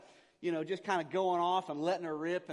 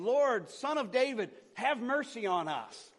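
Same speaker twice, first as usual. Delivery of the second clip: slow, raised call for mercy; shouting plea; 6 semitones higher, 5 dB louder, slower than usual. A narrator's reading. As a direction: call out loudly, stretching the words. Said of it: "they began shouting, saying".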